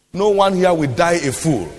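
ordinary talk

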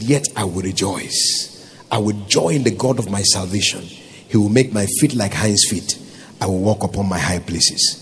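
low, subdued recitation of a verse; scripture recitation. Acted. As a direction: quote the verse softly in a low register.

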